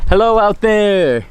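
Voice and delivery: loud, slow call out the window. Real, not acted. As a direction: call out to friends below. Calling out from plane.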